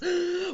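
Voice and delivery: loud, panicked intake of breath; frantic keeper's gasp; alarmed gasp. A narrator's reading. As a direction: gasp in horror.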